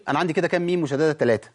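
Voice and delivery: ordinary delivery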